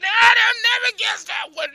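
loud high cackling laugh through the words; evil witch cackle. Acted. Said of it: "the Neb start laughing".

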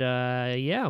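normal talking voice

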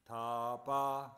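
loud, slow divine call from nowhere; mysterious voice. Acted. The unseen Lord calling down to Brahma, loud and solemn. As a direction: let it ring out like a voice from the sky. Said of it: "this voice".

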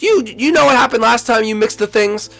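high, loud disbelieving scold; exasperated scolding. Acted. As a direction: scold him for mixing them again. Scolding the mixing.

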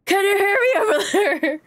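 high-pitched calling out; mock yelling. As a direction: call across a distance, playfully. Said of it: "I'm yelling like".

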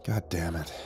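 low, very soft curse; whispered. A narrator's reading. Narrator at a retreating backside, hushed. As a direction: curse under your breath. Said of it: "they whispered".

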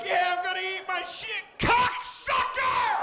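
over-excited. Acted. Customer thrilled about his takeout food, over-excited yell. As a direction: build to a screaming boast. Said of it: "You're all excited".

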